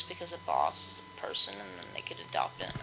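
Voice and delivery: soft whispered aside; whispering. Real, not acted. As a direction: whisper it to yourself.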